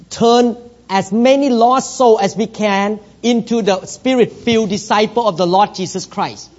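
normal talking voice

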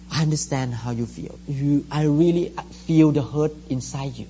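tender affirming. Shown as a demonstration. Pastor shows the congregation how to comfort a crying person, softly. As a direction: soothe someone who is crying. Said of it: "You need to affirm people feeling".

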